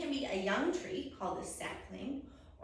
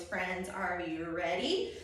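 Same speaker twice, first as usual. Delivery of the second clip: usual speaking voice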